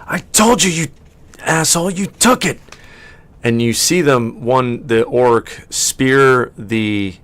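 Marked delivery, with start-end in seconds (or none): 0.1-2.6 higher-pitched quarrelling fantasy brawler; angry fighter. Acted. Game master as a brawler accusing another, angry. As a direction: accuse your foe in a high, worked-up character voice. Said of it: "you hear one of them say".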